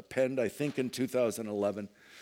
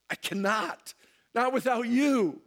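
high, rising plea that builds louder; anxious plea; 11 semitones higher, 4 dB louder than usual. Acted. Believer pleading with Christ at the cave, high and louder. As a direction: beg him not to leave you.

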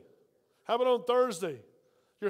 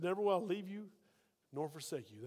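soft reassurance; 3 semitones lower, 8 dB quieter than usual. Retold or imitated. Quoting Jesus' promise softly.

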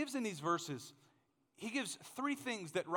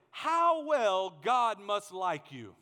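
loud, slow scripture quote; emphatic proclamation; 2 semitones higher, 9 dB louder, much slower than usual. Real, not acted. Preacher quoting the Message Bible to his congregation, emphatic. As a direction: proclaim it slowly and loudly.

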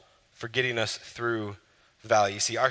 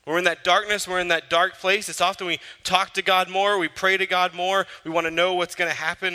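a touch louder, lifted preacher voice; preaching with rising force; 5 semitones higher, 4 dB louder than usual. Acted. Preacher describing nearness to God in the dark, fervent. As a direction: press it on the room.

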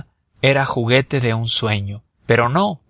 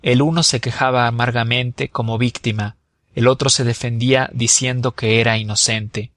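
ordinary delivery

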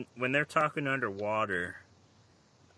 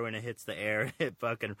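ordinary talk

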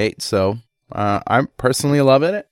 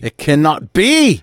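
Scotty exclamation; 6 semitones higher, 5 dB louder, slower than usual. Pretend. Mock Scotty engineer exclaim.

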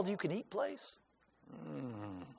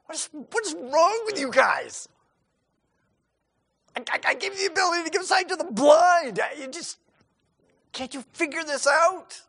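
high, loud exasperated yelling; frustrated shout; 11 semitones higher, 5 dB louder than usual. Pretend. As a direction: blow up at the disciples in mock rage. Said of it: "you get frustrated".

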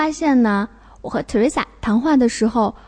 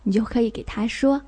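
ordinary delivery

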